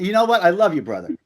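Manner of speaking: usual speaking voice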